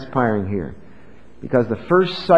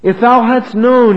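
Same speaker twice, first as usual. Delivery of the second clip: "If thou hadst known" cried out louder and higher, slowly, full of grief; anguished lament; 9 semitones higher, 8 dB louder, slower than usual. Shown as a demonstration. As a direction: let the grief break through, slowly. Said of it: "Can you feel the emotion of this?".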